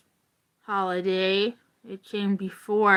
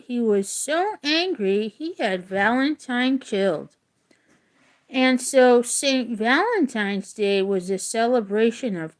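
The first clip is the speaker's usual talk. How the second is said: steady read-aloud voice, a touch livelier than chat; reading a story aloud; 3 semitones higher, 3 dB louder than usual. Acted. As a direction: read the legend clearly to the class. Teacher reads story to class.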